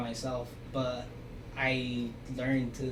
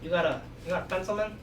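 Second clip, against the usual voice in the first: lightly put-on accent, pitched up; quick, higher imitation with an accent; imitated classmate's accent; 4 semitones higher than usual. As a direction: mimic the classmate's joking accent. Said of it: "with the accent".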